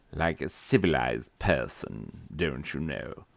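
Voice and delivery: put-on refined British accent; mock-posh British accent. Teacher playing the civilized person, mock-posh British accent.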